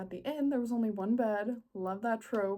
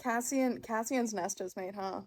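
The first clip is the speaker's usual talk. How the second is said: normal talking voice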